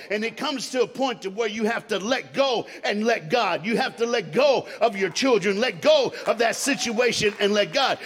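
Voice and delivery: loud, rising preacher's cry; preaching at full force. Acted. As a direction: preach it hard to the congregation.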